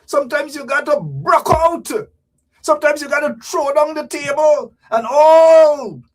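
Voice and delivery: loud, high, impassioned rant; rousing shout. Acted. Urging the crowd to break out, shouted. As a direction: shout it out, dragging out ALL.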